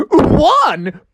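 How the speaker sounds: high-pitched final countdown cry; Mad Hatter. Acted. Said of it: "the Mad Hatter said".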